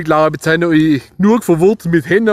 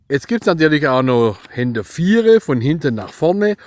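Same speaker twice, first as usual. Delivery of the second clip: ordinary talk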